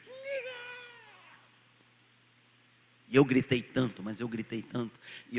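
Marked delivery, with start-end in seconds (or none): 0.1-0.6 desperate shout. Retold or imitated. Swept out to sea, calling his son on shore, desperate high shout. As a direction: scream the name from far away. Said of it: "O nome do meu filho".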